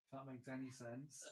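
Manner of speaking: ordinary delivery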